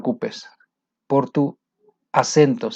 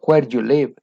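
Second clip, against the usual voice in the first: "Where you live?" with the pitch dropping on "live"; falling intonation. Shown as a demonstration. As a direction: let "live" fall at the end, English style. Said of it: "la entonación es foreign intonation".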